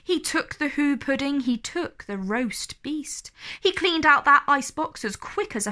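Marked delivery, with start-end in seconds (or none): none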